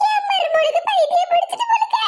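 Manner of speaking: high-pitched cry of dismay; high, distressed old-lady lament; alarmed wail. Acted. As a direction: wail it in mock-tragic alarm. Mother-in-law thinks she went mad.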